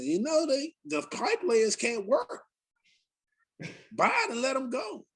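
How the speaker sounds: a hint of a four-year-old reciting news; imitating little daughter. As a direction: parrot the news like a small kid.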